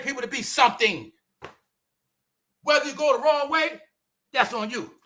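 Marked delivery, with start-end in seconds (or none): none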